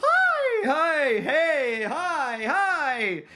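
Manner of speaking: high, loud squealing hellos between laughs; excited shrieking. Real, not acted. Streamer greeting raiders with loud high excitement. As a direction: burst into thrilled high greetings. Raid arrives mid-bit.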